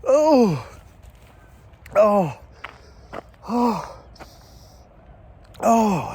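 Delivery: high strained cries of pain. Real, not acted. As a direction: cry out from the chili burn. Burning mouth after ghost pepper.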